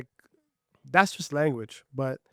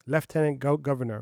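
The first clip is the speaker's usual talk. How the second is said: ordinary talk, nothing marked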